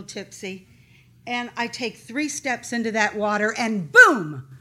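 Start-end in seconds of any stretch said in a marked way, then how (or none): 3.9-4.5 sudden explosive BOOM, pitched up; loud boom. Real, not acted. Storyteller hits the water's wall, sudden loud boom. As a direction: blast the word out loud. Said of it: "that was loud".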